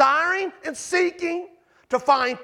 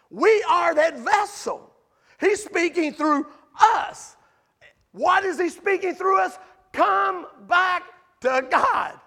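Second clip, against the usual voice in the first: higher, slow, ringing call; impassioned preaching; 4 semitones higher than usual. Real, not acted. Preacher urging congregation, high slow impassioned call. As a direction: plead it like a preacher's climax.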